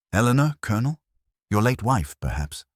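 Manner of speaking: high, pointed rising question; sharp question. Acted. Poirot's sharp intense question.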